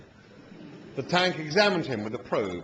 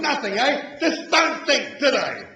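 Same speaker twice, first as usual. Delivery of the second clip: much higher, loud furious shout; furious roar; 11 semitones higher, 5 dB louder, slower than usual. A narrator's reading. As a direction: rage at the insult. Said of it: "it roared".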